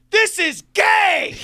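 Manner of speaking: high, loud, stretched-out yell; mock heckler hollering at full volume; outraged listener shout. Host voicing a bored listener, shouting.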